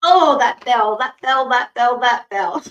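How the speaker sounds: loud, playful chant of mock complaint; loud, rhythmic repeats; playful exclamation. Real, not acted. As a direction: exclaim it loudly over and over, nearly laughing. Reacts to the timer bell ringing.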